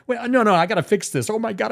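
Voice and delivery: mock panic rushing to repair; hammed-up panic; full blown panic. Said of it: "you go into full blown panic".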